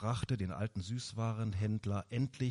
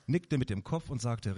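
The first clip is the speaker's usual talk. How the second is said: plain reading voice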